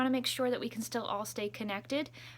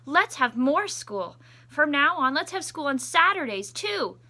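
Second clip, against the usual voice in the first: excited principal; 6 semitones higher, 7 dB louder than usual. Acted. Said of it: "called all the students and teachers together and said".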